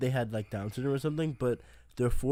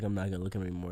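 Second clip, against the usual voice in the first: quiet; 7 semitones lower, 7 dB quieter, much faster than usual. Retold or imitated. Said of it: "and I was like".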